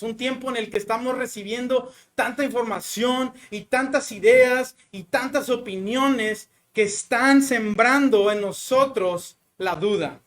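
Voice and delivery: usual speaking voice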